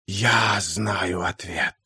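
hissing, breathy whisper; whistling whisper. A narrator's reading. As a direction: whisper it with a hiss. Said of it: "послышался сзади свистящий шёпот".

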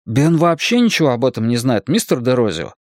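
ordinary delivery, nothing marked

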